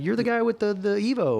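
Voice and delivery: high excited fan voice; imitating a fan. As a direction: squeal it like an eager fan. Fans recognizing him, mimicked.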